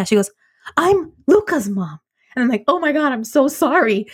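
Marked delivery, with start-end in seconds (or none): 0.8-2.0 slow, higher, pointed quote of another mom; pointed reveal. Retold or imitated. Other mom tells her whose mother she is, pointed. 2.7-4.1 higher retold apology; gushing apology. Apologizing to Luca's mom at art class, gushing.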